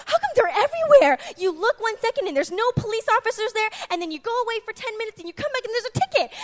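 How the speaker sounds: high, bewildered complaint; imitating mom. Daughter imitating her mom's ticket complaint, high and bewildered. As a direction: squeak out mom's disbelief at tickets. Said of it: "she's like".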